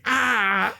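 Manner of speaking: high mock "ah" of pain; drawn-out, high-pitched yelp; mock pain cry. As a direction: cry out as if hit in the face. Acts out man hit with wrench.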